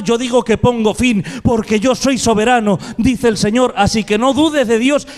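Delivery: forceful, insistent pulpit voice; impassioned preaching. Real, not acted. Preacher declaring God sovereign over the impossible, impassioned preaching. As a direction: proclaim it with rising conviction.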